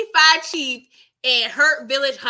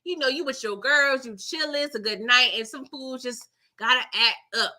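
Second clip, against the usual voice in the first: ordinary talk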